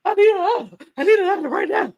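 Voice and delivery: high, pleading squeal; hammed-up plea for comfort; mock meltdown. Player freaking out over triple elimination, pleading in mock distress.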